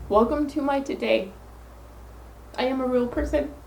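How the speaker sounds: holding back tears while getting the words out; tearful, choked up. Real, not acted. Vlogger apologizing to viewers for her emotions, tearful. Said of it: "my crybaby attitude".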